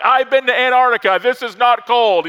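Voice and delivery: high, loud mock brag; bragging Canadian. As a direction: shout the boast, hammed up. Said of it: "someone in Canada is like".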